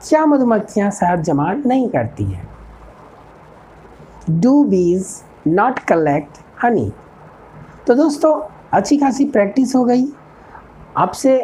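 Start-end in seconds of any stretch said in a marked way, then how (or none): 4.4-6.9 each word spaced out for learners; a teacher dictating an English model sentence, slow and clear; slow dictation. Shown as a demonstration. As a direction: spell it out slowly like a teacher at the board. Teacher slowly dictates model question.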